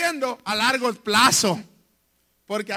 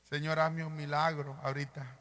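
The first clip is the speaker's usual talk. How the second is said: low, soft plea to God; hushed, intimate prayer for a miracle; quiet prayer; 9 semitones lower, 8 dB quieter than usual. Real, not acted. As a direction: pray it softly under the breath. Pastor prays with eyes closed.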